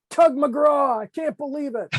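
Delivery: very high, loud awed squeal; imitating Kissinger starstruck. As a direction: gush like a star-struck fan. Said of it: "Kissinger looks and goes".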